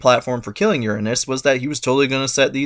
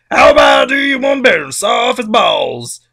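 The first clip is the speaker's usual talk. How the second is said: high, loud eager shout; Cronus shouting; 12 semitones higher, 7 dB louder than usual. Retold or imitated. Cronus shouting his plan to his mother. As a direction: pitch the gory plan with gusto. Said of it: "And Cronus is like".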